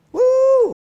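a loud, high whoop of joy; excited whoop. Real, not acted. Preacher whooping at the congregation over grace, loud and high. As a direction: let out a big high woo for the crowd.

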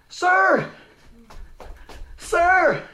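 high, loud holler; calling out. Retold or imitated. Imitating greasy-armed man calling the preacher, hollering. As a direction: call someone back from far off.

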